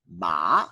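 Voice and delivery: Ma sliding upward like a question; second tone Ma; rising tone. Shown as a demonstration. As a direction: ask Ma with a rise. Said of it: "Sing along at home".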